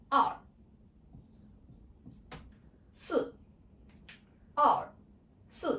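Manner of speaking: "R" and "si" short, firm, dropping; falling fourth tone. Shown as a demonstration. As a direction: drop "R" and "si" sharply, as if angry. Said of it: "This is be short and firm".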